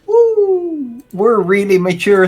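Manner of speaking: high loud cheer; excited whoop. Real, not acted. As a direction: whoop it up in triumph. Cheering after passing check.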